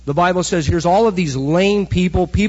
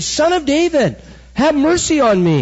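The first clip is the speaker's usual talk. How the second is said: high-pitched cry for mercy, pushed up sharply; screaming; 10 semitones higher than usual. Retold or imitated. Preacher voicing the blind beggar screaming to Jesus, high cry. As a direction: cry out desperately over a crowd. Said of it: "and he's screaming".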